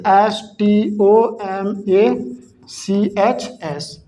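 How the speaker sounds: slow letter-by-letter spelling; careful dictation for the class. Shown as a demonstration. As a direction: spell each letter out slowly. Teacher spells word letter by letter.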